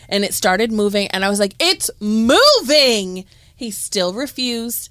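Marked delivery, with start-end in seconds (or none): 1.6-3.2 louder, higher, drawn-out panicked cry; alarmed cry. Retold or imitated. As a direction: sound the alarm as it moves. Centipede starts moving.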